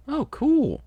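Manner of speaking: very high, loud "oh, cool"; laughing delight. A narrator's reading. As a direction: gush it in a giddy high voice. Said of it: "Sonata laughed".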